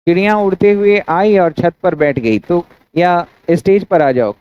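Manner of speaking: ordinary talk, nothing marked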